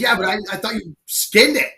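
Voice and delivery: high-pitched mock exasperation; exasperated butcher. Butcher arguing back over the unskinned pig, exasperated. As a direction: imitate a butcher protesting, voice pushed up high.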